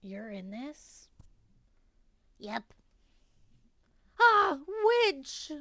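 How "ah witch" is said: loud startled cry; startled shout. Acted. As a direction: shout in shock at the witch. Sudden sight of the witch.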